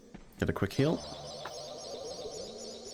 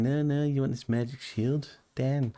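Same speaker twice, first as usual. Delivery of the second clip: a shaky, self-admitted bad Scots accent quoting a game character; half-hearted Scots brogue, a bit higher; amateur Scots accent impression; attempted Scots accent; 6 semitones higher, slower than usual. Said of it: "I can't do a Scots accent".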